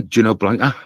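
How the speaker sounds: ordinary talk, nothing marked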